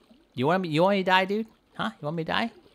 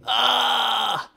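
loud, higher frantic yells; panicked shouting; 5 semitones higher, 4 dB louder, slower than usual. Real, not acted. Fish player shouting as the whale attacks again.

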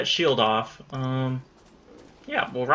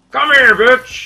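shouted taunt; 8 semitones higher, 10 dB louder, slower than usual. Real, not acted. Taunting boss during fight.

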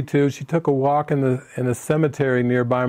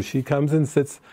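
normal talking voice

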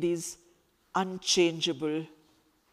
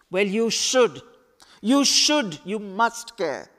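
firm insistence; 3 semitones higher, 7 dB louder than usual. Acted. Khalid insists firmly.

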